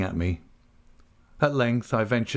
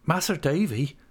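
higher-pitched rustic plea; imploring countryman, raised pitch; imploring Ham; 6 semitones higher than usual. A narrator's reading. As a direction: plead in a country dialect, pitched up. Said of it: "implored Ham".

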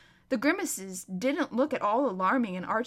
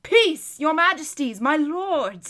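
loud, raised royal call for peace; calling for order; 5 semitones higher, 7 dB louder, slower than usual. Acted. King to lords on their feet, calling for order. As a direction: quell the room firmly.

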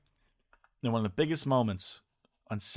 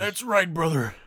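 higher, louder wrestler growl-free hype; Macho Man voice; 6 semitones higher, 3 dB louder, slower than usual. Pretend. Answering his friend in Macho Man's voice. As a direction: strut it like a wrestling promo. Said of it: "Macho Man Randy Savage".

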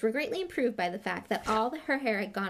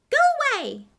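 very high, loud squeal; high-pitched cry to be left alone; squeak; 12 dB louder, faster than usual. A narrator's reading. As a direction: squeak it out in alarm. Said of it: "Hermione squeaked".

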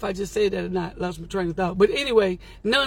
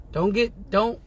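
usual speaking voice

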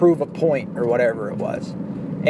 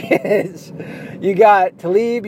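ordinary talk

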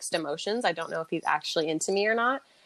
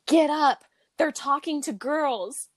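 drunk mock outrage; 7 semitones higher, slower than usual. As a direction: storm in and wake her up, indignant. Drunk angry wake-up call.

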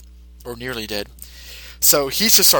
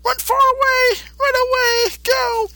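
Boo shouting; slower than usual. Retold or imitated. Boo warning Mister Satan to run, in a very high frantic shout. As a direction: yell at him to flee before you blow. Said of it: "Boo says".

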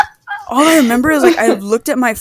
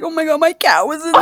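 mock crying friend; 6 semitones higher than usual. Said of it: "she's crying, and she's like".

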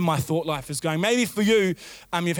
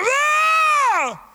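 high, loud scream at everyone; frustrated yell; 5 dB louder than usual. Acted. Parent walks into chaos once again, yelling at everyone. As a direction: explode in one long, high-pitched yell. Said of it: "And you yell at everyone".

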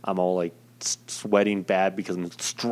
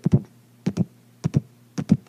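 mouthed irregular heartbeat booms; heartbeat imitation. Shown as a demonstration. Describing his odd heart rhythm, voiced booms. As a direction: tap out a stuttering heartbeat with your voice. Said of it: "it was like".

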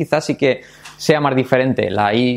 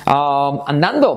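ordinary talk, nothing marked

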